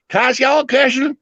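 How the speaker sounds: high Southern woman's voice. Retold or imitated. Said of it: "she says".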